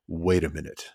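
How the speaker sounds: soft, low and slow, as if it just sank in; dawning realization. Retold or imitated. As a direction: let the weight land quietly, drawn out. Acts out new parent's dawning realization.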